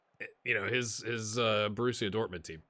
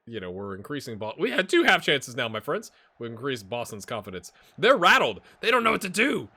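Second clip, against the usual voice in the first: ordinary delivery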